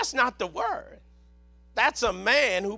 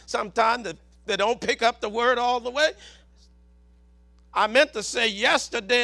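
ordinary talk

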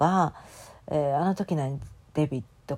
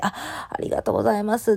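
quick, higher, breathy-edged thank-you; grateful thanks; 5 semitones higher, faster than usual. Retold or imitated. Accepting a stranger's kindness, quick higher grateful thanks. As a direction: gush out a quick surprised thanks. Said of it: "ってしてもらって".

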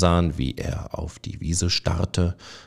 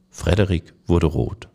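usual speaking voice

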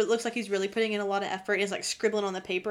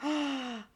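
shocked gasp; 2 semitones higher, 7 dB quieter than usual. Real, not acted. Realizes recording ruined.

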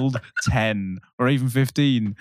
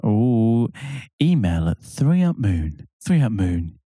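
mock announcer voice; 4 semitones lower than usual. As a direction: sell it like a cheesy radio ad. Mock podcast sign-off plug.

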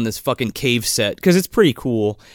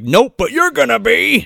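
higher, slightly louder comic threat; mock menacing retort; 8 semitones higher, 4 dB louder than usual. Tom Arnold looming over him in his bedroom, mock menacing retort. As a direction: throw it back as a gleeful threat.

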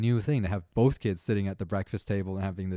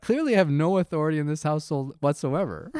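mock defeated; 5 semitones higher than usual. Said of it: "I'm trying not to giggle".

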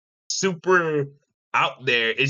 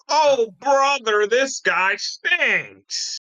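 frat bro; 7 semitones higher, much slower than usual. Pretend. Quoting a meme voice.

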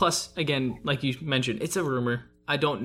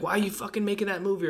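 mock-exasperated complaint, pitched higher; upset fan; 6 semitones higher, faster than usual. Gamers griping about film adaptation choices, mock upset. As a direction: imitate an upset gamer protesting. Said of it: "we always get upset with the choices".

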